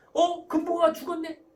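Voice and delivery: loud, high, breezy brush-off; 대수롭지 않게. Acted. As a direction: dismiss the child's loss as nothing. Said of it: "이게 별거 아니다 스타일이에요". (별거 아니라는 듯 높고 크게)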